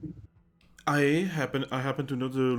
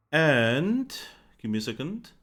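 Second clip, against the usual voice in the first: ordinary delivery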